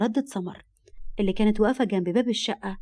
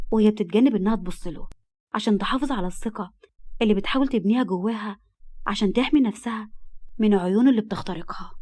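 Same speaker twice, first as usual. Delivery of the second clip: usual speaking voice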